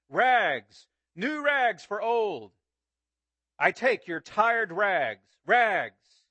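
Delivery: loud, slow, drawn-out peddler's cry; a ragman calling through the alleys at dawn; street call. Acted. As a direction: sing it out down the street to sell. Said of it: "calling in a clear tenor voice".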